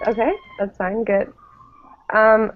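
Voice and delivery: ordinary talk, nothing marked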